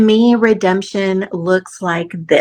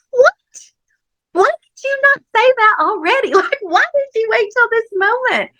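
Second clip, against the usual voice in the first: much higher, incredulous squeal; shocked disbelief. Retold or imitated. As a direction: squeak out the outrage with a laugh. Her shocked reply to husband.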